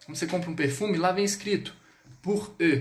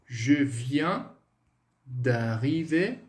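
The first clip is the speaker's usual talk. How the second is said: very slow, drawn-out model French, a little low; slow pronunciation demo; 3 semitones lower, much slower than usual. Shown as a demonstration. Teacher showing how the phrase will sound, slow demo. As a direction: stretch each word with long gaps between. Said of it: "Então, vai ficar assim".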